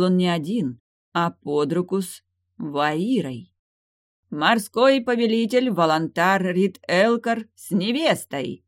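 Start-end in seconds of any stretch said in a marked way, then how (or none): 4.3-8.6 loud, slow herald proclamation; town crier. A narrator's reading. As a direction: proclaim the arrival to the hall. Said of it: "провозгласил".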